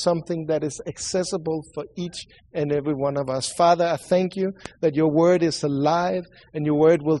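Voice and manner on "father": normal talking voice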